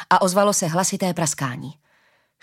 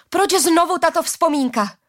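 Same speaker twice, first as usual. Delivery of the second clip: high-pitched, frustrated cry; exclaiming; 9 semitones higher, 3 dB louder than usual. A narrator's reading. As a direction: cry out the question. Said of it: "vykřikla Arila".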